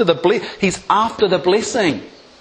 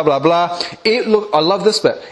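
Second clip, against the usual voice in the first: normal talking voice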